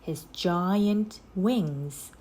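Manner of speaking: ordinary delivery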